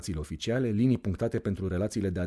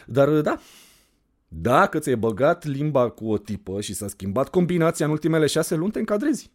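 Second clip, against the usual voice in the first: put-on higher, louder girl's voice; teasing girl; 8 semitones higher, 7 dB louder than usual. Anda explaining her chart rule to the narrator, higher teasing voice. Anda's voice held.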